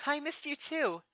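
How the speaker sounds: high, fond words to the owl; tickled by the owl's pecking; playful princess. Acted. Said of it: "she laughed".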